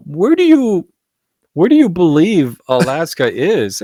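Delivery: incredulous question. Retold or imitated. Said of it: "And I go".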